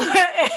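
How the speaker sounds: high loud laugh; sudden loud laugh; burst of laughter. Real, not acted. Laugh after acted line.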